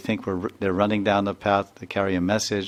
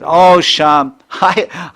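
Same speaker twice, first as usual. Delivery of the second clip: teasing laugh; 8 semitones higher, 12 dB louder, much slower than usual. Retold or imitated. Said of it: "then Tulsi says".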